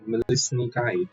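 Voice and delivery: usual speaking voice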